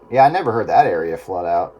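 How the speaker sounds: ordinary talk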